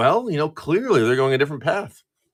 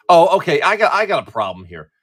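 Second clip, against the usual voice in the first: normal talking voice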